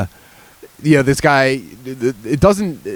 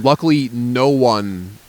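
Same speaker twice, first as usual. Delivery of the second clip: ordinary talk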